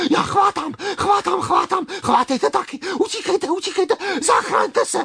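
very high-pitched, breathless beetle in panic; frantic cry. A narrator's reading. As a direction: urge everyone to flee, panting and frantic. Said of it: "vykřikl kvapník".

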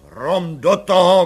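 loud, slow barked order; shouted. Acted. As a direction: snap a loud command. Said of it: "křikl komisař". (hlasitý, pomalý rozkaz)

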